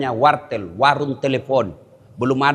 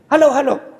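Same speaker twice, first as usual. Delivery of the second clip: calling into the phone; 9 semitones higher, 5 dB louder than usual. Retold or imitated.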